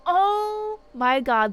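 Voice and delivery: slow stunned exclamation; shocked oh my God. Real, not acted. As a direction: let it stretch in real shock. Realizing nobody warned her.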